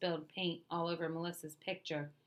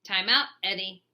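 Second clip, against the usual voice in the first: stern order; 10 dB louder than usual. A narrator's reading. Teacher sends Eddie away.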